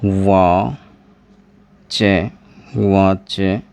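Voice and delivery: वा and चे said apart; teacher splitting the word; syllable by syllable. Shown as a demonstration. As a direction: sound out वा चे slowly. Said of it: "ऐसे लिख सकते हो".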